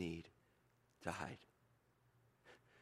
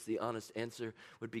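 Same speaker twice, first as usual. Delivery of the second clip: ordinary delivery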